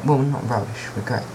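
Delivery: usual speaking voice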